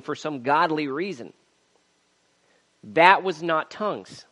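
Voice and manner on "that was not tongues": loud, high, emphatic preaching, building in force; forceful preaching. Real, not acted. Preacher refuting the tongues lesson just shown, forceful raised preaching. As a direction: drive each point home to the congregation.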